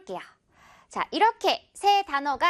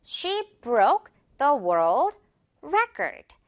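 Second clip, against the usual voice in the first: slow model sentence; much slower than usual. Shown as a demonstration. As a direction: read out carefully for learners. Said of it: "broke로 써볼게요". (아주 천천히 읽어주는 예문)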